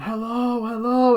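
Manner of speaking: high, loud hello called into the dark; calling out. Retold or imitated. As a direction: call out as if trapped in a cellar. Jenna Ortega calling from basement.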